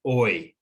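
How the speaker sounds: "oi" gliding from back to front, drawn out slowly; diphthong demo. Shown as a demonstration. Teacher demonstrates a second diphthong, slow gliding oi. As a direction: stretch "oi" so the vowel moves forward. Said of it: "starts at the back of the mouth and moves forward".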